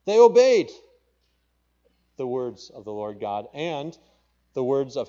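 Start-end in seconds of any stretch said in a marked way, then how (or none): 0.1-0.7 they obeyed, shouted high and loud; emphatic shout. Real, not acted. Preacher to the congregation, a high ringing shout. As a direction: proclaim it like a triumph. Preacher stressing the people's obedience.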